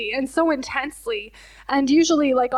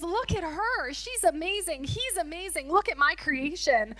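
tender admiration; 4 semitones higher, 8 dB quieter than usual. Retold or imitated. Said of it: "looks at us that way and goes".

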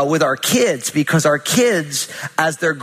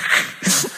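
breathy chuckle. Real, not acted. Laughs at own warning.

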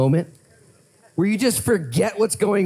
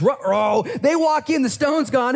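ordinary delivery

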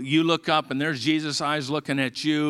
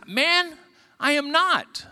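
voiced denial; 13 semitones higher, slower than usual. Peter denies, voiced in story.

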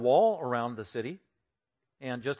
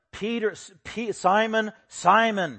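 higher, emphatic calling of the name; imitated rebuke; 7 semitones higher, 5 dB louder, slower than usual. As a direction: call him out sternly by name, twice. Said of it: "Jesus would say to rebuke him".

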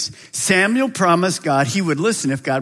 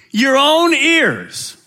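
slow, raised stress on Your own ears; slow emphasis; 8 semitones higher, 4 dB louder, much slower than usual. Acted.

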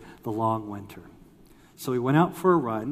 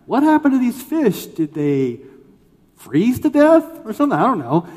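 high, loud mock-clever question; hammed-up know-it-all, pitched way up; trying to sound smart; 9 semitones higher, 10 dB louder than usual. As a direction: imitate yourself showing off with a guess. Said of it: "I'm trying to be all smart".

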